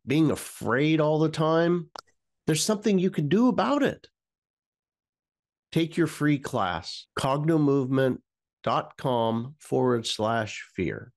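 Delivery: breathy promo voice; whispered ad read. Acted. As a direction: whisper the pitch close to the mic. Recorded ad whisper.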